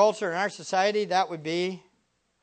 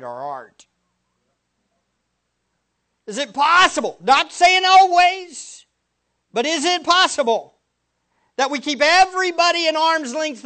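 higher and louder, climbing to a shout on always; full-force preaching; 9 semitones higher, 11 dB louder than usual. Acted. Preacher pressing the congregation with rising intensity. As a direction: hammer the question home, building.

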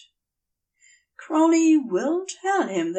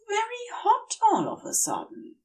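high, soft, dazed voice; fevered complaint; 13 semitones higher, 3 dB quieter than usual. A narrator's reading. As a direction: murmur it, half lost. Feverish Aziraphale speaks.

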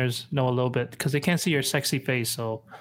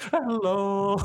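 mimicked Korean hello, higher and laughing; sing-song Korean hello; 4 semitones higher than usual. Friend copying the Korean store greeting, sing-song through laughter. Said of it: "they always sing".

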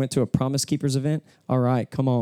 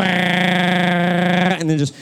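weed eater drone; 6 semitones higher, 7 dB louder, much slower than usual. Retold or imitated. Imitating weed eater noise.

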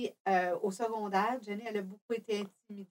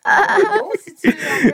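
excited; 11 semitones higher, 16 dB louder, much slower than usual. Real, not acted. Mother excited about mic.

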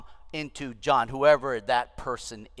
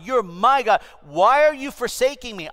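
high, loud, impassioned plea; anguished cry; 10 semitones higher, 8 dB louder than usual. Acted. Jesus crying to his God from the cross, loud pleading. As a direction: plead loudly as the forsaken Jesus.

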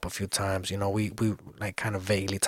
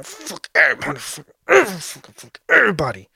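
loud, higher-pitched venting; frustrated outburst; 5 semitones higher, 5 dB louder than usual. Real, not acted. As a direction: blurt it out louder, fed up. Venting about stress, then swears.

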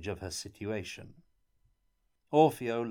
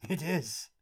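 surprised hope; 7 semitones higher than usual. A narrator's reading. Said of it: "in surprise expectation".

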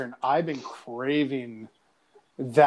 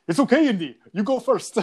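higher, louder put-on movie accent; playful accented impression of a film sidekick; Indiana Jones movie accent; 6 semitones higher, 4 dB louder than usual. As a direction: quote the sidekick in a hammed-up accent. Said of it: "you sound just like that dude from Indiana Jones".

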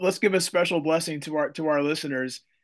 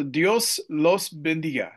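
slow gringo-accented "Dios los bendiga"; Spanish with a heavy American accent; slower than usual. Shown as a demonstration. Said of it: "my gringo accent there".

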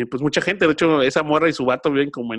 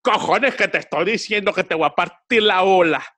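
higher mock Spanish reggaetonero accent; 6 semitones higher, 3 dB louder than usual. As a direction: ham up a Spanish gangster accent. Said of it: "entre reggaetonero español".

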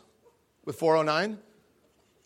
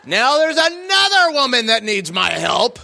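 loud, high mock-sarcastic "oh perfect", half laughing; sarcastic exasperation; 8 semitones higher, 9 dB louder, slower than usual. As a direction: roll your eyes out loud at yet another chore. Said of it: "I'm like".

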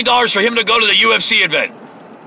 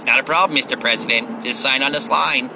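lower, softer mock yes-man; lower, softer, eager to please; fawning aide; 8 semitones lower, 4 dB quieter than usual. Aide answers the president's request in a lower, softer mock voice.

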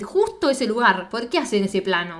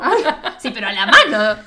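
talking through laughter, getting louder; laughing; 6 dB louder than usual. Real, not acted. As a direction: laugh out a disgusted protest. Se ríe del chiste asqueroso. (entre risas, escandalizada)

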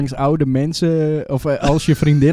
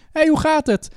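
high-pitched mock hail; quick high call in passing; passing greeting; 13 semitones higher than usual. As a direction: greet someone riding past, pitched way up. Cyclists greeting as they cross.

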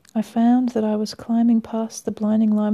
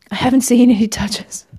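cried out; 2 semitones higher, 6 dB louder than usual. Acted. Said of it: "I cried".

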